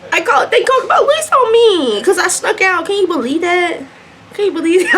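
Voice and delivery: high-pitched, worked-up disbelief; incredulous outcry. Real, not acted. Storyteller outraged they called police over sneaking out, incredulous outcry.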